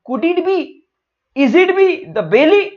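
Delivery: high-pitched, wondering guess; dawning realisation. Acted. Parts realise it is belly. (हैरानी भरा ऊँचा सवाल)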